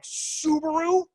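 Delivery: disbelieving Subaru?; incredulous. Retold or imitated. Rival companies doubting Subaru, incredulous high drawl. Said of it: "they're like".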